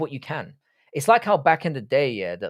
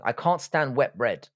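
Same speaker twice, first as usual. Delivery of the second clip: ordinary delivery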